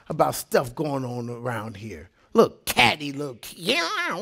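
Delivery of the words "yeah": drawn-out mock meow; imitated cat yowl. Preacher mimicking the catty mess, mock cat yowl.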